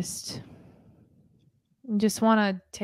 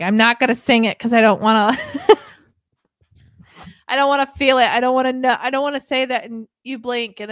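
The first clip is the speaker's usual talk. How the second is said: 1 mock anguished outburst; 4 semitones higher, 5 dB louder than usual. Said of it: "and I'm like".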